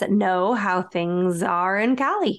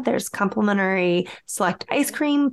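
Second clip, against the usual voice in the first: ordinary talk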